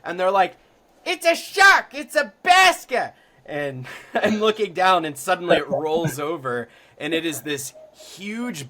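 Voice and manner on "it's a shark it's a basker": high, loud Boston fisherman's shout; excited Boston holler, drawn out at the end; thick Boston accent. Retold or imitated. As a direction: yell the sighting in a broad Boston accent. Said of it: "with this thick Boston accent".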